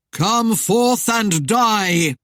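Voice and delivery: very slow, loud, haunting command; droning call. A narrator's reading. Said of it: "the droning voice called".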